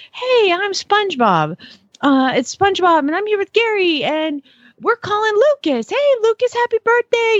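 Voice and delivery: high, sing-out cartoon birthday greeting; SpongeBob. Retelling the cartoon's birthday call to a little boy, high imitated greeting. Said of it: "it is the actual voice of SpongeBob".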